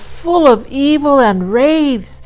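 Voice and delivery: high, loud dread-filled warning; terrified cry. A narrator's reading. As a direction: cry out in horror of the woods. Hunter flees in terror.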